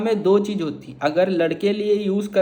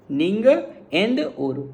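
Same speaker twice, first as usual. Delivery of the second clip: slow Tamil demo; slower than usual. Shown as a demonstration. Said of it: "ये मतलब होता है".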